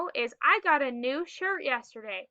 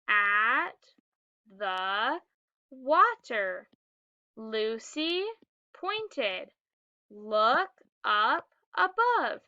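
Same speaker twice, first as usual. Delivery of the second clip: word-by-word reading; 3 semitones lower, much slower than usual. Shown as a demonstration. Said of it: "Let's read it together".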